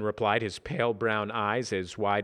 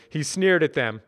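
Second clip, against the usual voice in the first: plain reading voice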